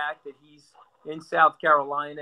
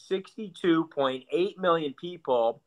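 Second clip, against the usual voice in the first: usual speaking voice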